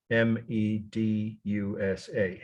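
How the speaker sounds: ordinary talk, nothing marked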